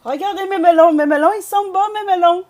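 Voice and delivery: high, loud hawking; playful bellowed sales pitch; market seller's cry. Pretend. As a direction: sing out your wares to passing shoppers.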